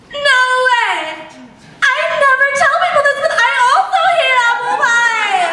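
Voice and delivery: put-on excited girly voice. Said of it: "the other girl responds".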